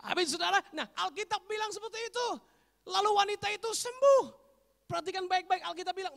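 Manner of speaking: high, ringing proclamation; full-force preaching. Acted. Preacher citing scripture on the healed woman, high preaching. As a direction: proclaim it loudly to the congregation.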